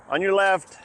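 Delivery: loud high warning call; passing cyclist calling out. Real, not acted. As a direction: shout it out while riding past.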